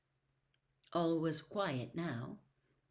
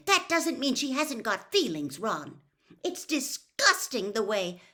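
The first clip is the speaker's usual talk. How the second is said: higher, louder heated retort; rounding on Ron; 6 semitones higher, 6 dB louder than usual. A narrator's reading. Hermione rounding on Ron, heated retort. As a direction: turn on him sharply. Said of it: "Hermione rounded on him".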